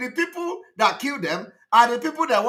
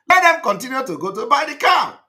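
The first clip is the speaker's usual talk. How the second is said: louder, higher, furious shout; angry shout; 5 semitones higher, 7 dB louder than usual. Real, not acted. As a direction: throw it out in disgust. Angry rant at listeners.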